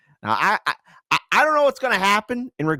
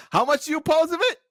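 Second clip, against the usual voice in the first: high, fast mocking squeak; mocking question; 12 semitones higher, much faster than usual. Vlogger taunting the looping clip, high fast mocking question. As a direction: needle her with a squeaky taunt.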